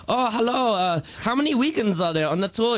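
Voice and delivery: high put-on German voice; German promoter. Musician imitating a German tour promoter, high accented voice. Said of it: "they'd be like".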